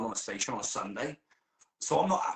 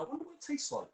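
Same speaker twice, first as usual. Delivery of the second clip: high, softer creepy wondering; high odd musing; 4 dB quieter, faster than usual. Retold or imitated. Me musing to a dog owner, high and odd. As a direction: muse oddly, high and soft. His own weird remark.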